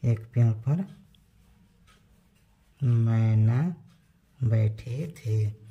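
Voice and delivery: "मैना" drawn out after long pauses, as if writing; dictating slowly. Shown as a demonstration. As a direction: spell it out slowly while writing on the board. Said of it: "यहाँ पर लिख देते हैं". (लिखते हुए धीरे-धीरे बोलना)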